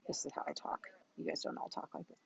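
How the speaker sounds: breathy near-silent whisper, a touch fast; confiding whisper to the audience; whispered aside. Real, not acted. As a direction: drop to a whisper for a side comment.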